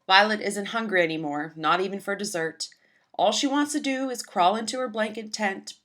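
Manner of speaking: ordinary delivery, nothing marked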